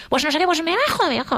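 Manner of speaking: high, furious threat to get out; angry outburst. Retold or imitated. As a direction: storm out of the car mid-fight. She storms out of the car. (arrebato de rabia, agudo)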